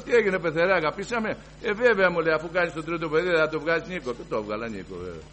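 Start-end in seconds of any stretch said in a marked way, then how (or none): 0.0-4.1 higher, louder mock exchange; teasing banter. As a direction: tease the father-in-law. Teasing exchange with father-in-law. (πειρακτικός διάλογος με τον πεθερό, πιο δυνατά)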